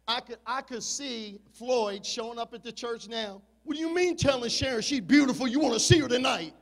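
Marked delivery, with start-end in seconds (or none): 3.6-6.5 imitating a jealous husband, louder and fast; fast, confronting challenge; angry husband.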